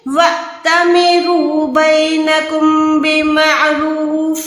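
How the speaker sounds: وَأْتَمِرُوا with a sharp stop on the hamza; slow recitation with a jerked hamza; glottal jerk. Shown as a demonstration. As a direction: catch the throat on أْ in وَأْتَمِرُوا. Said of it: "اس حمزہ پہ جھٹکا دینا ہے".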